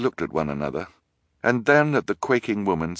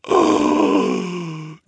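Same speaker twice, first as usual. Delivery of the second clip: gasp; 4 dB louder than usual. A narrator's reading. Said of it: "she bellowed".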